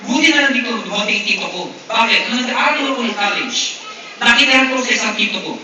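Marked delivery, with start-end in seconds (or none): none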